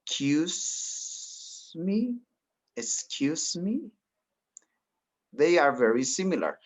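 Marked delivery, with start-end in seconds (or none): 0.1-3.9 breathy, very slow whispered model phrase; drawn-out hushed excuse me; soft demo whisper. Shown as a demonstration. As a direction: whisper it slowly like a polite aside. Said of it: "you have the expression".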